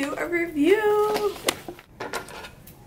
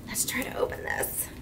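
whisper; 9 dB quieter than usual. Real, not acted. Whispers the same line.

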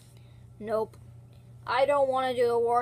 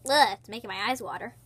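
ordinary talk

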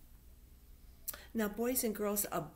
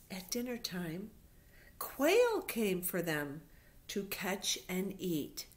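plain reading voice

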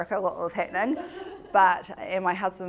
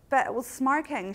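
higher, insistent protest; raised voice; 8 semitones higher than usual. Retold or imitated. To the girl defending the burnt pumpkin, raised voice. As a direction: push back hard, voice up high. Arguing about the burnt pot.

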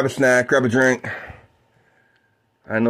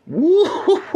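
high woo with a laugh; laughing whoop. Real, not acted.

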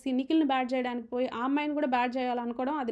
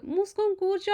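మూసుకొని కూర్చో said higher and sharper than before it; higher mock retort on మూసుకొని కూర్చో; retold raised voice; 5 semitones higher than usual. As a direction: throw మూసుకొని కూర్చో back a bit higher, mock-heated. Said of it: "అనేసి".

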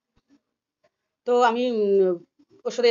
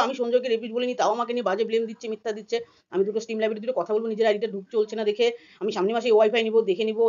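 ordinary talk, nothing marked

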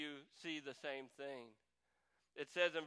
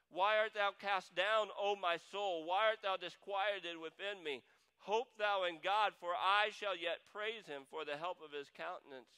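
louder, rising, fervent reading of the psalm; fervent scripture reading; 4 semitones higher, 6 dB louder than usual. Acted. Pastor reading Psalm 42 to the church, fervent and louder. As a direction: lift the psalm up with passion.

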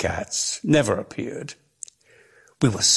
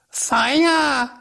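cried out. Acted. Mrs Prothero crying alarm to the garden, high and shrill. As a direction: shriek it like a panicked housewife. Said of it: "cried Missus Prothero".

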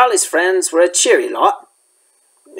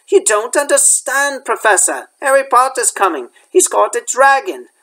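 high, pleading schoolboy protest; Malfoy pleading; 8 semitones higher than usual. A narrator's reading. Malfoy protests to Professor McGonagall, high and pleading. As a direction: protest your innocence to the teacher, pitched up high.

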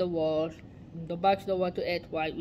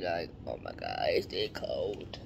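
much lower, softer cold complaint; mock low grumble; 5 dB quieter than usual. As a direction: drop the voice way down, grumbling.

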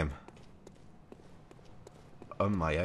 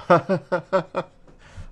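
high loud joyous laugh; elated laughter; 13 semitones higher, 11 dB louder than usual. Real, not acted. Player just beat the boss, loud elated laugh. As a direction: laugh out the relief. After the win.

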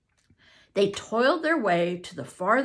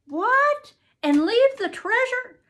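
high, loud disbelieving outcry; can't believe he'd give up the treasure; incredulous; 8 dB louder than usual. Acted. Tom to Huck, shocked at leaving treasure, high outcry.

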